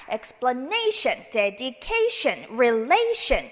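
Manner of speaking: "explanation", "dedication", "relation", slow on NA, CA, LA; stress before -tion. Shown as a demonstration. Teacher showing where the accent goes, stressed before "tion". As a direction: stretch the syllable before each "tion". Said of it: "이렇게 액센트 주시면".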